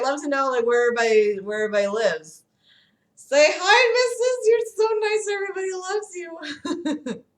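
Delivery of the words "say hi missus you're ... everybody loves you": much higher, sing-song voice for the dog. Real, not acted. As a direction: gush lovingly at the pet. Talking to her dog.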